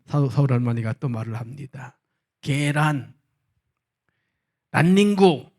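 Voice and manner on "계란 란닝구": higher and louder, triumphant; eager game move. Acted. Seoul grandma slaps down her word in the game, higher and louder. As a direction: fire off the word like a winning move.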